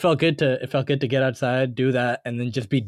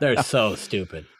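slowed, laughing repeat; 3 semitones lower than usual. Real, not acted. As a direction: say it again laughing, slowly.

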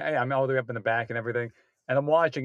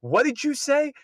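put-on angry girlfriend; 10 semitones higher than usual. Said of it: "she gave it to him".

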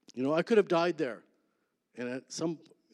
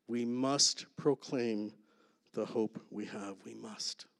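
slow emphatic; 3 semitones lower, 6 dB quieter, slower than usual. Real, not acted. Sermon climax slowing down.

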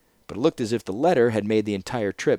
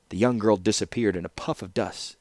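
plain reading voice

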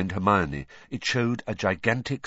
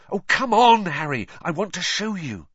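high, loud "come on"; acted exasperated coaxing; impatient urging; 8 semitones higher, 5 dB louder than usual. A narrator's reading. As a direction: plead with him to hurry. Impatient girl urging her friend along.

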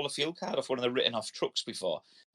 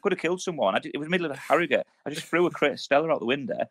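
ordinary talk

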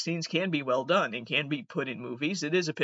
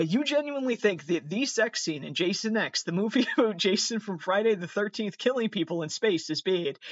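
ordinary talk

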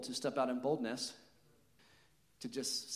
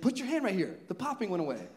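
high, loud, excited urging; acted amazement; 7 semitones higher, 5 dB louder than usual. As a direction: imitate someone thrilled by a sudden discovery. Healed man's amazed discovery, acted.

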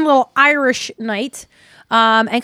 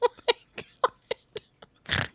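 high laughing squeal, then breathless; helpless laughter; 9 dB quieter, much slower than usual. Real, not acted. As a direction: lose it laughing. Cracking up at co-host.

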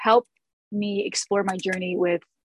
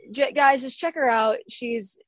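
normal talking voice

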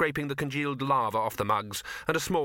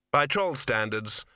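usual speaking voice

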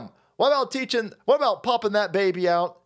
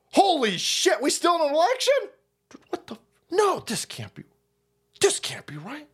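much higher, panicked disbelief; mock shock; 10 semitones higher than usual. Dead staffer realizing the election's still on, high mock panic. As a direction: gasp it out in horror. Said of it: "And then he was like".

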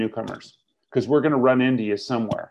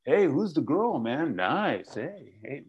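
leering buddy; 3 semitones higher, much slower than usual. Retold or imitated. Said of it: "somebody's gonna come up to you and go".

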